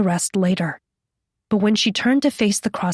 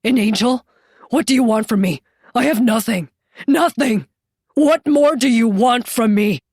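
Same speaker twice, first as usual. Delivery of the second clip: mounting angry demand, louder by the end; enraged outburst; 3 semitones higher, 4 dB louder than usual. A narrator's reading. Yeshua lashing out at Jenny, enraged. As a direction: burst out in rage, building in force. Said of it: "blinking at the sudden enraged outburst".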